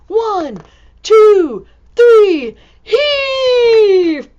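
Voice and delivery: loud rising count, big shout on heave; heave-ho cry as everyone pulls together; shouting the count while tugging the stuck elephant. Acted.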